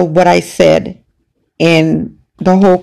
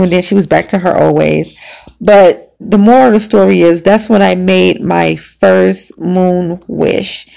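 ordinary talk

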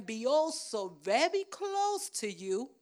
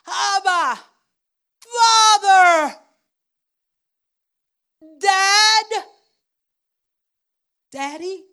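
loud, drawn-out cry to the Father, higher; anguished calling out; 8 semitones higher, 18 dB louder, slower than usual. Retold or imitated. Jesus in Gethsemane crying out to his Father, loud anguished call. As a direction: cry out for Daddy with everything. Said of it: "He called out".

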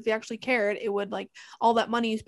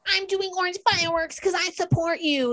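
mocking politician; 9 semitones higher, 4 dB louder than usual. Mocking Trudeau's empty support.

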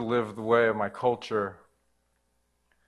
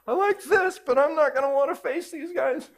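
high embarrassed voice; 13 semitones higher than usual. Retold or imitated. Moved but embarrassed before friends.

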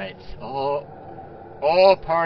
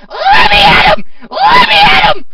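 shrill, very high screaming battle cry; skeleton child yell; 15 dB louder, slower than usual. Acted. The tiny skeleton child charges the party screaming, very high. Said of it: "and he yells".

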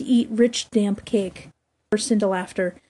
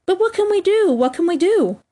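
high, helpless exclamation; alarmed cry; 8 semitones higher than usual. A narrator's reading. Boys face wolves chasing Nibs, alarmed cry. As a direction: raise the pitch in mild panic.

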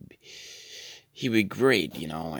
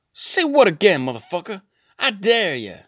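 much higher, much louder menacing yell; Samuel L Jackson impression; 10 dB louder than usual. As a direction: bellow the dare like an angry Samuel L Jackson. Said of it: "he'd be like".

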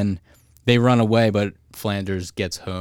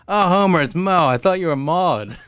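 higher and louder, lightly voiced barman; a loud, raised cartoon bartender voice; louder, higher mock barman; imitating Moe; 8 semitones higher, 6 dB louder than usual. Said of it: "He's like".

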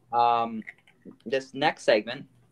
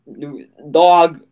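louder, higher New Jersey holler; New Jersey accent; 4 semitones higher, 11 dB louder than usual. Acted. Host trying out a requested accent, New Jersey accent. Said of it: "that was my impression of a New York, New Jersey person".

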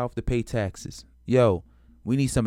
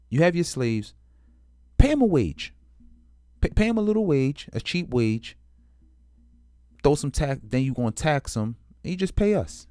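mock Northerner; 5 semitones higher, 4 dB louder than usual. North voiced demanding wages.

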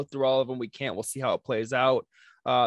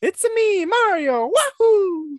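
very high, louder cheery cartoon voice with a whoop; Mario; 8 dB louder, much slower than usual. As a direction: bounce it up like the plumber. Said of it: "Gotta get the wahoo".

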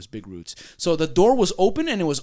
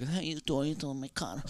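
soft, fumbled attempt at a Romanian accent; half-remembered Romanian accent; 2 semitones lower, 12 dB quieter than usual. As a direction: try her Romanian accent quietly. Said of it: "I don't remember the accent".

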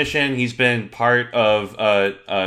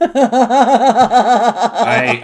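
laughing; 10 semitones higher, 7 dB louder than usual. Real, not acted. Laughing at the drawing.

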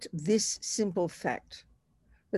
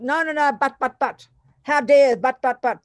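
higher, sputtering "no, no, but, but" protest; mock angry rant; 5 semitones higher, 4 dB louder than usual. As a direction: imitate anger's flustered objections, half-playfully. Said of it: "anger wants to kind of".

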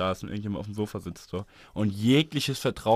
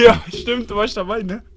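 sarcastic yelp; 10 semitones higher, 9 dB louder, slower than usual. Acted. Sarcastic mock agreement with friend.

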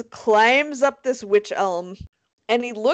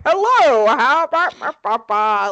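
talking skull puppet; 5 dB louder than usual. Acted. Storyteller playing with a found skull, silly talking-skull voice. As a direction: babble like a skull being worked by hand. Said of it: "making it talk".

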